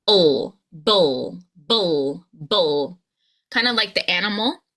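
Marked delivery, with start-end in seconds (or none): 0.1-3.0 "bull" low, with a throaty dark L; B plus dark L. Shown as a demonstration. Coach drills the final cluster, low and separate. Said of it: "bring that B sound with the L".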